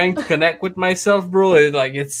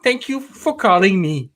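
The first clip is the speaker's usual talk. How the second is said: higher, sing-song churchy message voice; imitating religious voicemail; 5 semitones higher than usual. Caller mocking an old woman's religious voicemail, sing-song. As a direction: bless the caller in a lilting tone. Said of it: "It's like".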